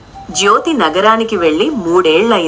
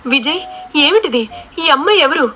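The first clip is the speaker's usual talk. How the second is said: stunned questions pitched much higher; shocked; 6 semitones higher than usual. A narrator's reading. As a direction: disbelief breaking into her voice. Jyothi shocked at wedding.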